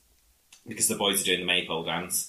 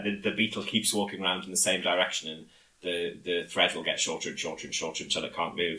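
ordinary talk

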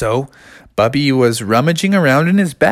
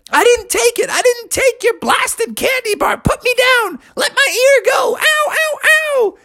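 very high, protesting little brother, crying ow; whiny kid brother. Acted. Junior held by the ear denying it, very high and whiny. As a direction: squeal in protest, then yelp in pain.

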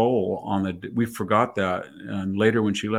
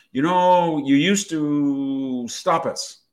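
raised, drawn-out mock Valley Girl; slow, higher Valley Girl imitation; Valley Girl accent; 4 semitones higher, 4 dB louder, much slower than usual. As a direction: stretch it like a Valley Girl, lightly. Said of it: "got into a Valley Girl accent".

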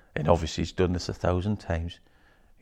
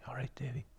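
whisper; 5 semitones higher, 18 dB quieter, faster than usual. Retold or imitated. Retold greeting whispered.

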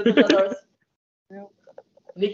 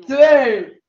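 loud, higher starting call; countdown shout; 5 semitones higher, 7 dB louder, much slower than usual. Real, not acted. Host starting a bread-eating race, shouted countdown. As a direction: call out the countdown, building in pitch and force.

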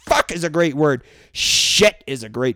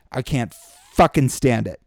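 ordinary talk

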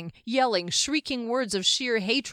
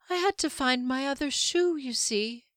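high, lightly voiced weary woman; a touch of exhaustion, pitched up; slow, exhausted; 5 semitones higher than usual. A narrator's reading. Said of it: "She spoke slowly, as though exhausted".

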